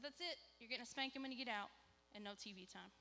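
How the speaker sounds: ordinary delivery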